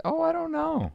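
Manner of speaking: very high, mock-innocent teacher voice; feigned innocence. Speech teacher covering to parents, feigned innocence. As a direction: play dumb in a high singsong.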